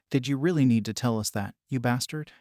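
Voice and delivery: plain reading voice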